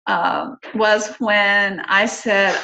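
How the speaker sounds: ordinary talk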